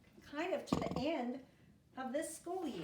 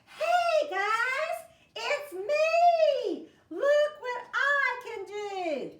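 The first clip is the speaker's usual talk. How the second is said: much higher, louder thrilled shouting; excited; 12 semitones higher, 10 dB louder, much slower than usual. Acted. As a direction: show off your new trick to friends. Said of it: "Does he sound excited?".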